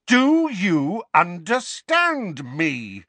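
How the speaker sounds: loud, high, very drawn-out demand; slow, enraged yell; furious shout. A narrator's reading. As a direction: bellow it slowly, word by word. Harry shouts his demand.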